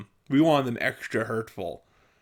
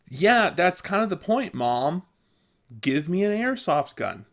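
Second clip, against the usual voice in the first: put-on bold, higher-pitched teenage sass; imagined back-talk; 6 semitones higher than usual. Kid demanding an airsoft gun from mom, mock defiant. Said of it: "I never said it to her like that".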